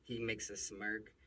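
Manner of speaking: ordinary talk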